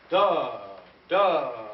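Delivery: very loud DA, held then tapering; big pushed attack on DA; accented half notes. Shown as a demonstration. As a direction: hit each DA hard with breath and let it ring. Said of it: "give me accented half notes".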